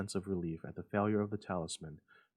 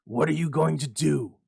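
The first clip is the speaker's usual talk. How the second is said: ordinary delivery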